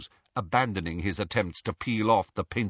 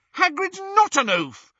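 very high, loud indignant protest; shrill; 7 dB louder than usual. A narrator's reading. Hermione defending Hagrid to the portrait, shrill. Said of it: "said Hermione shrilly".

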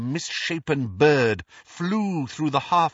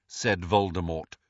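plain reading voice

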